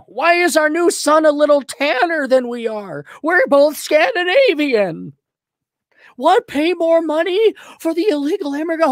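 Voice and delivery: high, meek henpecked husband voice, agreeing to everything; Minnesota husband impression. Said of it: "can you do Minnesota husband impersonation".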